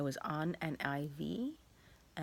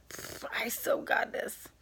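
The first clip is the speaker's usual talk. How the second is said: cocky whisper; 7 semitones higher, 5 dB louder, slower than usual. Retold or imitated. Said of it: "So I was like".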